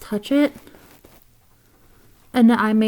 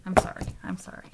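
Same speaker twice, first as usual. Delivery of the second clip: fast, softer whispered apology; hurried whisper; 2 semitones lower, 11 dB quieter, faster than usual. Real, not acted. Apologizing after the kickstand collapses, quick whisper. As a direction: rush out a breathy sorry, fast.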